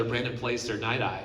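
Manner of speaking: ordinary talk, nothing marked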